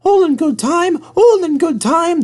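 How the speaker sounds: lazy carriages refusing to hurry; squeaky coaches complaining; grumbling coaches. A narrator's reading. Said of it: "the coaches grumbled".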